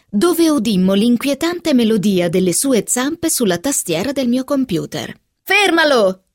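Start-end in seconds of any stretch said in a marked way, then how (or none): 5.4-6.2 loud urgent cry, pitched high; shout. Acted. Helen yelling at Catherine to stop the cat, loud cry. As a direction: yell it out to stop the kitten. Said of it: "gridai a Catherine".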